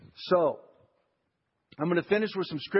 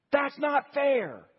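put-on high, slow whine of a child protesting; whiny child; 7 semitones higher, much slower than usual. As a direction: drag it out like a sulking kid. Child complaining to parent.

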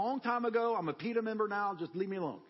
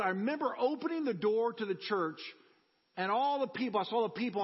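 ordinary delivery

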